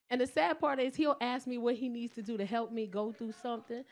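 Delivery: ordinary talk, nothing marked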